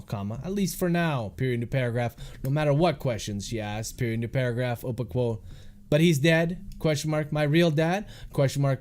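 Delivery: measured dictation, calling out punctuation; dictating. Real, not acted. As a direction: read the draft aloud with spoken punctuation. Author dictating his novel aloud.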